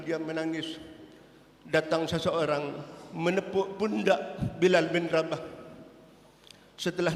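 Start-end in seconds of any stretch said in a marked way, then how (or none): none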